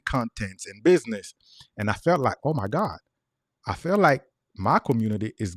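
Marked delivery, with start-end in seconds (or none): none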